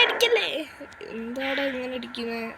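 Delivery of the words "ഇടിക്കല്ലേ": high panicked squeal. Real, not acted. Repeats it, voice jumps up.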